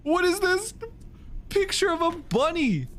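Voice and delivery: amused surprise. Real, not acted. Surprise picture in game.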